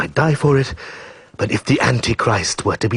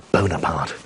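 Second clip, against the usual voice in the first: normal talking voice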